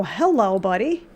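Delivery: usual speaking voice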